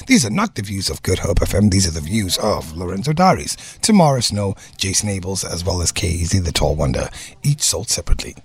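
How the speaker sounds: deep announcer disclaimer voice; toy commercial. Acted. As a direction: read it like the end of an ad. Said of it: "you just want an excuse to do that voice".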